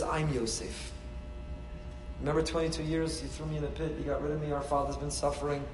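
soft, low, restrained disclosure; quiet reveal. Acted. Yosef quietly revealing himself to his brothers, soft and restrained. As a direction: say it softly, letting it sink in.

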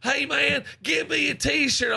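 high, drawn-out heckler holler; mock screaming. Fan hollering at man with t-shirt cannon, mock scream. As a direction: imitate a fan yelling from far away, no real volume. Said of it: "screaming and yelling at me".

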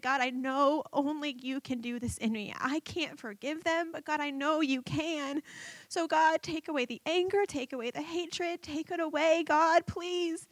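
high, soft, pleading voice; tearful prayer. Retold or imitated. Asking God to take her hatred away, tearful pleading. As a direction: beg gently, voice lifted and small. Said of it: "So through tears, I just said".

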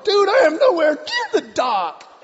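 high, frantic full-voiced yell; exasperated shout. Paddler protesting to the honking ship, exasperated high shout.

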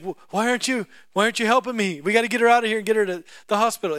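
high, loud, fast demand; frantic demand. Retold or imitated. Husband urging his wife to help. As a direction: push her to hurry, panicked. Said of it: "He's like".

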